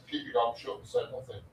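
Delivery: quick hushed whisper; a fast whispered aside. Real, not acted. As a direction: mutter it fast under your breath. Jokey aside about his answer.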